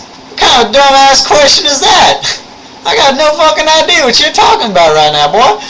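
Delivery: loud, high-pitched Southern rant; thick Southern accent. Retold or imitated. Lab instructor chewing out the kid, thick Southern accent. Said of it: "Southern accent, and I do an imitation of him".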